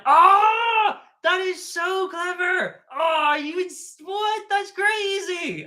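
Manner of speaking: overjoyed discovery, voice shooting way up; high, slow, stunned delight at a clever idea; freaking out. Retold or imitated. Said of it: "started freaking out".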